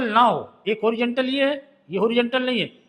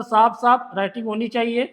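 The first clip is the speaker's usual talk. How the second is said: ordinary delivery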